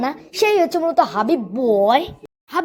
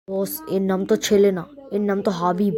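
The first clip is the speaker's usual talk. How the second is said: low sidekick voice; 7 semitones lower, 5 dB quieter than usual. Acted. Lackey voice in skit.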